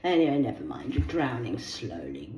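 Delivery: ordinary delivery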